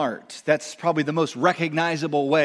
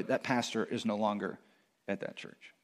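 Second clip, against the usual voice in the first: usual speaking voice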